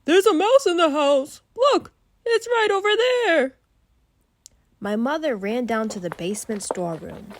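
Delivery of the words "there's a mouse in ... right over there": much higher, much louder panicked scream; dad screaming. Acted. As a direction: shriek it like a frightened dad. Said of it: "My dad screamed".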